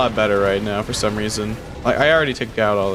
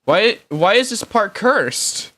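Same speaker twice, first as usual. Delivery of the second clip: exasperated; 8 semitones higher, slower than usual. Real, not acted. Frustrated at crash.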